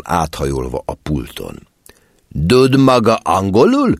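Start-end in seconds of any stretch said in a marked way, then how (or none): 2.5-3.9 stern customs officer. A narrator's reading. Angry officer leans over counter.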